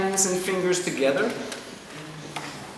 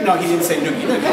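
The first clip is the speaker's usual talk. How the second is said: usual speaking voice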